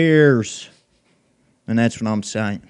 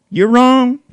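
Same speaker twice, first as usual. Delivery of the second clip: sudden high loud yell; louder, higher repeat of the insult; shouted retort; 9 semitones higher, 6 dB louder than usual. Real, not acted. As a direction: shout it back at him.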